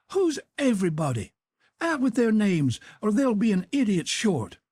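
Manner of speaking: an aunt demanding names; scandalized auntie; incredulous outrage. A narrator's reading. Aunt Sally threatens.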